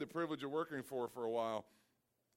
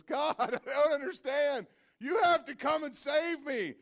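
high, loud, half-laughing plea; pleading cry with a laugh; 10 semitones higher, 9 dB louder than usual. Acted. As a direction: cry out, bewildered, with a laugh breaking in. Man pleads, water at neck.

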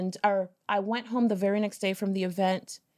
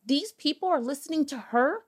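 scornful disbelief; 6 semitones higher than usual. Retold or imitated. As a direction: scoff in disbelief at the crowd. Inner hating at the conference.

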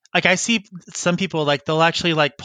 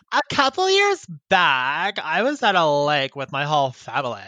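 Vivian. Acted. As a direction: read it aloud as an irritating comic character, stretching words. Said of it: "one of my characters, uh, Vivian. She's really annoying".

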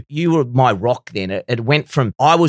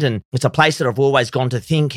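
ordinary talk

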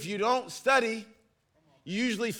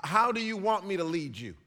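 ordinary talk

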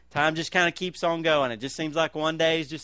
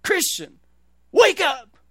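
much higher, much louder booming preacher's cry; Adrian Rogers wake-up call; 11 semitones higher, 9 dB louder, slower than usual. Retold or imitated. Alarm-clock sermon blasting a sleepy listener awake, booming shout. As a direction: boom it like a radio preacher jolting you out of bed. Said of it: "these are the words that Adrian Rogers said".

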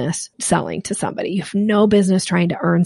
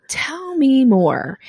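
slowly, drawn-out tell me more; slow intrigued; 6 semitones higher, much slower than usual. Retold or imitated. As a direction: savor it, intrigued. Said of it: "that makes them go".

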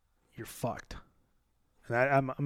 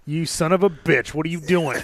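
mock outrage; 5 semitones higher, 8 dB louder than usual. Real, not acted. Greeting friend with mock outrage.

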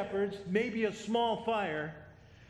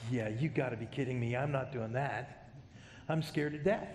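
lower, reluctant shepherd balking at the angel; frightened shepherd; 6 semitones lower than usual. Retold or imitated. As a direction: play a scared shepherd saying no way, in a low voice. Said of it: "I'm going".

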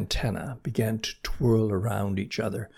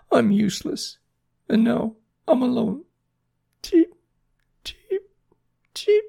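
very high, tearful little bird voice; crying baby chick; 5 dB louder than usual. A narrator's reading. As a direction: squeak it out in despair, ending in cheeps. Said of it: "cried Jerry".

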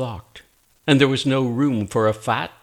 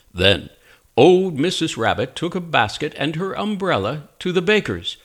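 ordinary delivery, nothing marked

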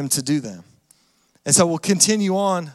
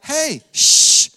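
higher, louder mock hushing; urgent shushing; 5 semitones higher, 6 dB louder than usual. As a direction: play an objector hushing a crowd. Pharisees acted hushing the crowd.

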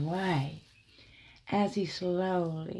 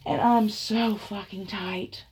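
plain reading voice

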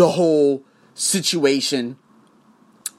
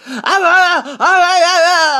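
much higher, louder, slow stuttering uhs with a sob-like catch; mock flustered stammer; 13 semitones higher, 8 dB louder, slower than usual. Pretending to be flustered by an angry clerk, hammed-up sob-like stammer. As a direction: stammer as if thrown off balance.

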